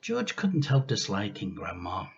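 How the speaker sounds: usual speaking voice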